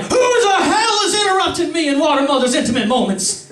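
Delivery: high-pitched indignant shriek; outraged interruption. Acted. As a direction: screech at an intruder. Acted outrage at being interrupted.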